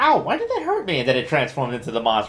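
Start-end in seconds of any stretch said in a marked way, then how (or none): 0.0-1.0 high startled yelp of a question; suddenly hurt and confused; surprised. Retold or imitated. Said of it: "I was like".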